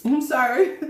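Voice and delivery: apologizing through a mouthful; mouth full. Real, not acted. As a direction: talk around a bite of food. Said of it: "I'm just eating".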